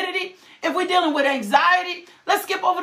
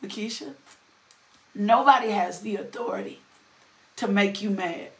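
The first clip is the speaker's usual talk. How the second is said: mother's calm correction; 7 semitones lower, 5 dB quieter than usual. Retold or imitated. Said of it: "my mother paused me and she said".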